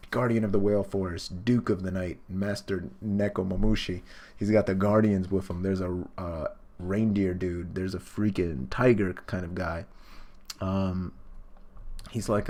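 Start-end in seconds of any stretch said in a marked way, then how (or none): none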